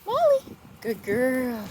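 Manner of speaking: very high, sing-song calling; calling the dog back. Real, not acted. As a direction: coax the pup back with bright calls.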